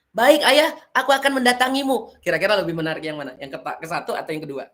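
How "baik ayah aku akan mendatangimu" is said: put-on much higher, louder eager boy; storyteller voicing the son answering his father; son's voice. Shown as a demonstration. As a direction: answer brightly as the obedient son. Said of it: "ada perubahan suara di sana".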